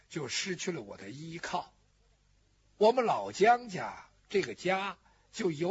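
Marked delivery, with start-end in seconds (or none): 0.1-1.7 失 leaned on, then the line drops much softer and lower; softly dropping after stressing 失; quiet grief on 失. Acted. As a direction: let 失 sink into a soft, fading lament. Lament, paired lines on 失.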